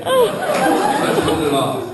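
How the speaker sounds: cracking up at his own joke; laughing. Real, not acted. Comic to the audience after his man boobs joke, laughing loudly.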